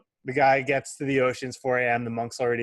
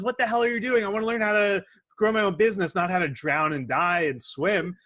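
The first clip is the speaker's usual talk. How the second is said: outraged student; 7 semitones higher than usual. Retold or imitated. Student yells at the monk after being dunked, indignant outburst. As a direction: protest after nearly drowning.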